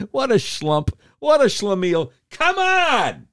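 incredulous high-pitched scoff; scoffing exasperation. Real, not acted. Radio host scoffing at a politician, incredulous squeal. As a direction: voice leaps high on come on.

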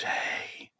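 HEY as a very soft whisper; imitated ghost voice. Imitating the voice found on the recording, whispered. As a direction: breathe out HEY in an eerie whisper. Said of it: "You hear this".

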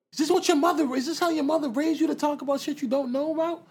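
high-pitched indignant telling-off; mock scolding. Retelling Nicki getting at Joe, high-pitched mock scolding.

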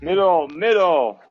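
drawn-out, loud, high mock call; slow sing-song taunting call. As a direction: stretch out the nickname teasingly. Mocking call of nickname.

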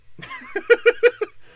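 a sudden shrieking laugh at her own joke; cracking up at her own dark joke; loud high laugh. Real, not acted.

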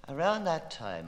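usual speaking voice

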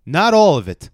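loud, high retold protest; emphatic acted objection; mock firm refusal. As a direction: imitate a man putting his foot down. Retold refusal in checkout argument.

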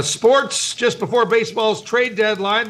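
usual speaking voice

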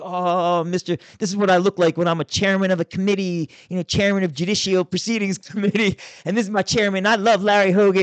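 high, loud sing-song parody of a fawning colleague; mocking imitation. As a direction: ham up a bobbleheaded yes-man. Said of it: "bobbling his head like a bobblehead".

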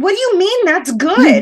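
high, loud, re-enacted disbelief; incredulous. Wife challenging husband's reaction, incredulous. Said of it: "I'm just incredulous".

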